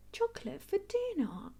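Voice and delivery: high, quick disbelieving question; Mummy Sheep doubting the chocolate, surprised. Acted. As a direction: query it like a startled mum. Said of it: "asks Mummy Sheep".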